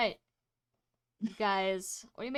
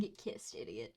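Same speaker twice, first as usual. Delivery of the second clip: teasing; 10 semitones lower, 6 dB quieter than usual. Pretend. Teasing after kiss.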